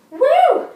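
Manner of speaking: loud high whoop of triumph. Real, not acted. Coach to viewers after a hard set, loud whoop. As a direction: let out a big cheer.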